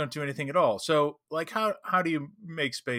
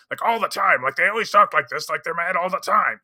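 louder, higher angry guard voice; 6 semitones higher, 9 dB louder than usual. Shown as a demonstration. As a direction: sound mad all the time. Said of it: "just make 'em sound angry".

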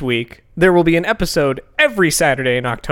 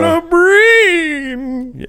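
jack o lantern voice; 13 semitones higher, 6 dB louder, much slower than usual. Acted. Joins the spooky opener.

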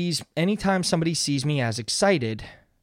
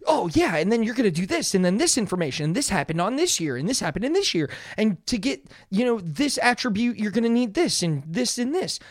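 higher-pitched, rushed imitation of excited info-dumping; eager rambling; 5 semitones higher than usual. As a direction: reel off details in an eager tumble. Retelling own eager info-dumping at work.

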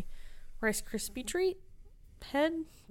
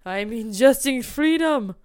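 louder, slow hammed-up patriotic boast; mock grand declaration; 5 semitones higher, 7 dB louder, slower than usual. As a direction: parody the co-host's line as pompous. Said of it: "I didn't say it like that".

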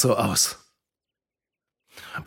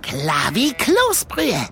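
comic quip; 7 semitones higher, much slower than usual. Acted.

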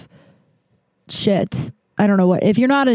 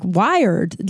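ordinary talk

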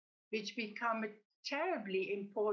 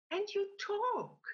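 astonished exclamation; 11 semitones higher than usual. A narrator's reading. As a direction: pitch jumps high in astonishment. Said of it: "exclaims the landlord".

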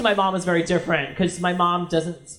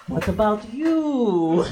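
put-on slow, drawn-out Greek-accented question; leading question in a Greek accent; much slower than usual. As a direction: imitate a Greek dad, stretching the question. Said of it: "this cute little Greek accent".

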